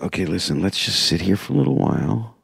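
soft, low voice sinking into a whisper; hushed conspiring. Retold or imitated. Comic conferring with friends during the play, hushed and conspiratorial. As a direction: murmur the plan secretly. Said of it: "lean in and just go like this to each other".

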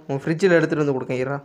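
ordinary talk, nothing marked

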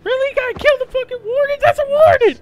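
high-pitched, rapid protest; shocked disbelief. Real, not acted. Player seeing the warden killed, shocked disbelief.

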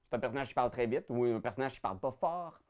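fast, rushed delivery; fast talker. Shown as a demonstration. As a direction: rattle it off quickly. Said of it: "Vous pouvez aussi parler plus vite".

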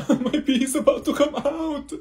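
usual speaking voice

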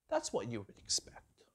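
a hushed whisper; whispered aside to the congregation. Acted. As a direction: drop to a whisper as if sharing a secret. A dropped aside after the quote.

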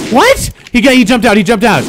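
high-pitched startled outburst; startled disbelief. Real, not acted. Player sees someone jump out, startled. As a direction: pitch leaping up in real surprise.

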